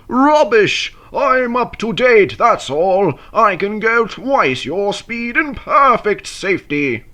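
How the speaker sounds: a cocky show-off engine brushing off a warning; high brash bragging voice; boastful engine. A narrator's reading. Boastful Lord Harry argues.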